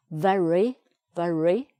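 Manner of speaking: "very" with a sounded middle R, rising at the end; teacher's careful "very", R pronounced; R on second syllable. Shown as a demonstration. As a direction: model "very" with a clear R opening syllable two. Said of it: "Second syllable".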